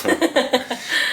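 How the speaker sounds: short airy laugh, high; breathy laugh. Real, not acted. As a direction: let out a quick breathy chuckle. Laughing at the husky remark.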